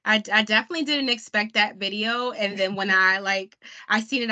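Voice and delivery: normal talking voice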